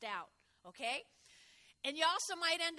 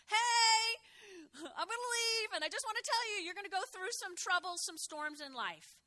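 higher, heartfelt, near-tearful voice of Jesus; voicing Jesus; 7 semitones higher, 6 dB louder than usual. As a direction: plead tenderly as Jesus leaving his friends. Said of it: "So Jesus is saying to all of us".

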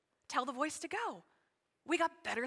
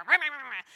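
loud nonsense grumbling; mock grumpy ranting; 9 dB louder, faster than usual. Pastor imitating a bad hair day, grumpy ranting. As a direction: imitate cranky ranting in noises.

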